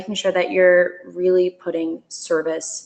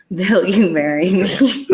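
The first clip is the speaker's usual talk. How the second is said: real laughter breaking into a slowed, louder line; cracking up; 4 dB louder, slower than usual. Real, not acted. Presenter cracked up by a partner's post, laughing. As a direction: say it through helpless amusement. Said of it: "it cracks me up every time".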